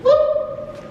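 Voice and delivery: high whoop to test echo; sudden high whoop into an empty room. Real, not acted. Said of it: "It echoes right now".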